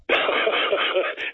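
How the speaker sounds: light amused laugh. Real, not acted. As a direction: chuckle at the joke. Laughs at own joke.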